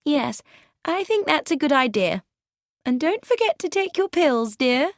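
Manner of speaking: high-pitched wifely reminder; high voice, solicitous wife urging her husband; wife's voice. A narrator's reading. Wife sends husband to bed.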